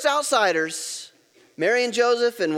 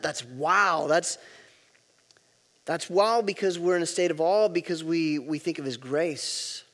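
normal talking voice

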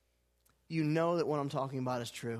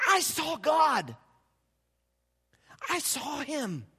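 awed climax; 8 semitones higher, slower than usual. Retold or imitated. Climax of Isaiah's vision.

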